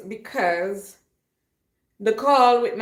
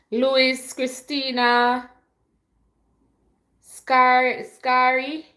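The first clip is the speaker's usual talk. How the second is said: loud, raised roll call; calling out names; 5 semitones higher, 5 dB louder, much slower than usual. Real, not acted. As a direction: sing out each viewer's name, held long. Calling out viewers' names in greeting.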